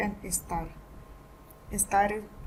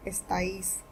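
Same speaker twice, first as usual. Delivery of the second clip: ordinary talk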